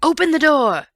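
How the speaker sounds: loud, high furious yell; man shouting through the door in anger; angry shout. A narrator's reading. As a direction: bellow through a locked door. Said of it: "it was shouting".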